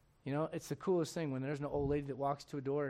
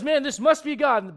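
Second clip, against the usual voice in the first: excited Elijah; 8 semitones higher, 11 dB louder than usual. Retold or imitated. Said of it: "he goes".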